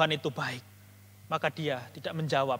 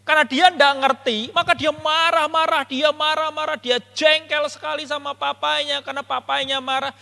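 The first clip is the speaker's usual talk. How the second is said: high, raised sermon delivery; impassioned preaching; 9 semitones higher, 7 dB louder than usual. Real, not acted. Preacher explains the sibling's misunderstanding to the congregation, impassioned. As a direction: drive the point home to the congregation. Preacher applies the story with force.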